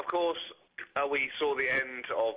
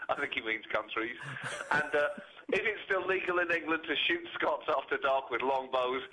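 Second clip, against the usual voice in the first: usual speaking voice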